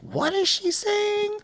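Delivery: very high, slow, bewildered falsetto; cartoonish baffled falsetto; baffled squeak. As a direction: squeak it out in total confusion. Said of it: "I'm like".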